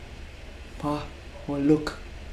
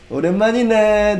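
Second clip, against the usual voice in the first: higher, louder, quick bossy greeting; bossy gangster tone; 6 semitones higher, 4 dB louder, much faster than usual. Shown as a demonstration. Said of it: "a little bit bossy".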